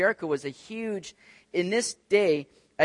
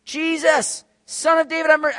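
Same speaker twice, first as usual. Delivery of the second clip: loud, high-pitched acted yell; high cry for help; 11 semitones higher, 6 dB louder than usual. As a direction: call urgently over a crowd. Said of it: "just yelling this out".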